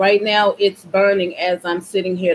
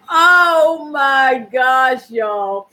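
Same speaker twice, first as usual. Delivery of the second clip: slow loud cry through laughter; laughing exclaim; 3 dB louder, much slower than usual. Real, not acted. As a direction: burst out amused. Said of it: "from laughing so hard".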